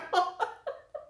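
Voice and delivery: breathless giggle. Real, not acted. Opening a funny gift.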